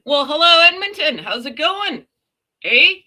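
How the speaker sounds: hammed-up Canadian hello, eh. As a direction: play up the Canadian accent with a big hello and a tacked-on eh. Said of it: "I say that 'cause I am Canadian".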